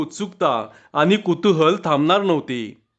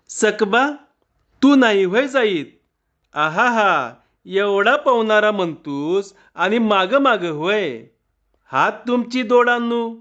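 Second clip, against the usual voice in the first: higher, goading woman's voice; teasing village woman; 4 semitones higher than usual. A narrator's reading. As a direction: egg the man on, mocking his cowardice. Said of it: "सारखी सखा पाटलाला विचारत होती". (पुरुषाला चिडवणारी गावकरी बाई)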